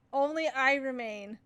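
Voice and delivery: usual speaking voice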